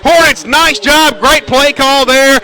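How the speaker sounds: building from lively to a high, loud shout; touchdown call. Real, not acted. Announcer calling a touchdown run for home listeners, ecstatic. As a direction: roar it as he breaks into the end zone.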